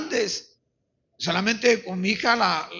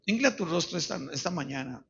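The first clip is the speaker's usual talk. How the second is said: lower, measured appeal with long pauses; solemn altar call; 4 semitones lower than usual. Real, not acted. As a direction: invite them gravely to search their hearts. Solemn closing appeal to congregation.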